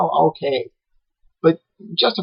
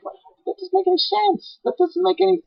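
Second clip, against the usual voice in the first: mock disbelief; 7 dB quieter than usual. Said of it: "And I'm like".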